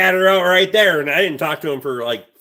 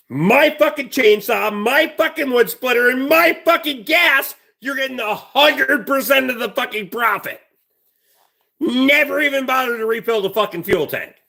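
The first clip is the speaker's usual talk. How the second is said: rising anger; 7 semitones higher than usual. Retold or imitated. Angry rant about neighbor using his tools.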